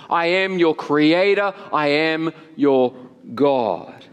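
measured, weighty proclamation with long pauses; solemn divine declaration. Acted. God naming himself to his people, slow and majestic. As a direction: let each title ring out, drawing out the last.

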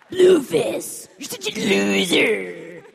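loud raspy bully voice, drawn out; Terrence voice. Retold or imitated. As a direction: sneer it like a bully. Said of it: "the hardest voice I did was probably Terrence".

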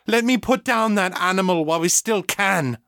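high-pitched, urgent anger; furious urging. A narrator's reading. Mother to Egan restraining her, furious. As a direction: argue fiercely while held back.

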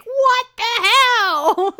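high, drawn-out, mock-outraged yell; outraged fan shout. Fans confronting the host in person, loud mock yell. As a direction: imitate baffled fans hollering in disbelief. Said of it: "lots of people in person being like".

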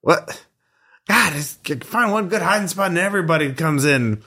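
high, exasperated little dude; cranky high character voice; scruffy squatter, annoyed. Acted.

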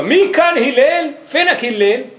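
high drawn-out mock call, slow; hollering up and down the street for someone. Man in the street calling for Hillel, high mock yell. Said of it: "um cara começa a gritar na rua".

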